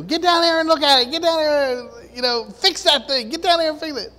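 high, loud, fast nagging wife voice. Retold or imitated. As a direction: nag him to get downstairs, high and loud.

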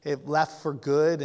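ordinary talk, nothing marked

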